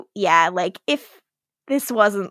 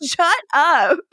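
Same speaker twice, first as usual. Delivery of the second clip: imitated laughing retort; 5 semitones higher than usual. Caroline answers her friend's teasing, imitated laughing retort. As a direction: toss it back, playfully amused. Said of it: "And she's laughing".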